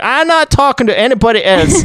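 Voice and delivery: put-on high, exasperated German accent; German mad scientist. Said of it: "decided to give him a German accent".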